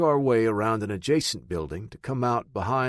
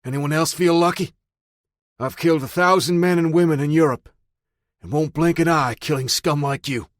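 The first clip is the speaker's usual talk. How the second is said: higher and louder menacing taunt; 7 semitones higher, 7 dB louder than usual. Acted. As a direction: taunt them as a hardened killer. Taunting the gang after killing.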